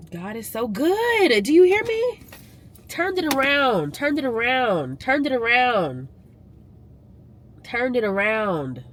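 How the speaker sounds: high, slow and emphatic, peaking on hear; praise testimony. Real, not acted. Vlogger testifying to viewers, high and slow praise. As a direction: preach it like a testimony.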